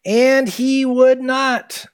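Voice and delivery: high, loud, very slow stress on would; emphatic refusal. Acted. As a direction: drag out "would not" with weight. Reading the refusal dramatically.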